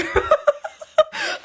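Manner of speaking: high rising burst of laughter; real amused laugh at the answer. Real, not acted. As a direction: laugh in disbelief. Laughs at team's unhelpful answer.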